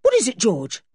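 high, startled question; urgent cry. A narrator's reading. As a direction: cry out in alarm at a friend. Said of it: "cried Julian".

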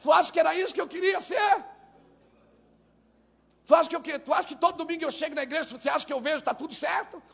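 high, forceful rhetorical questions; exasperated retold rant. Overburdened pastor venting at a complaining member, exasperated rant. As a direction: pile up indignant questions, voice pushed high.

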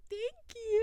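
high, sweet little voice; cutesy retold thanks. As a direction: imitate an adorable idol saying thanks.

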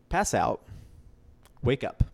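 usual speaking voice

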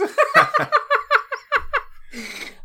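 shrieking laugh way up high; real high-pitched laugh; high laughing fit. Real, not acted. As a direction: burst out laughing helplessly. Laughs at massive penis.

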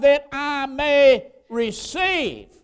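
loud, high, drawn-out preacher's cry; emphatic declaration. Acted. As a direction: proclaim it slowly at full voice. Preacher voices blind man's reply.

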